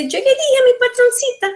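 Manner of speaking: high, rapid Mexican singsong; exaggerated Mexican intonation; Mexican accent. Shown as a demonstration. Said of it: "that is accent".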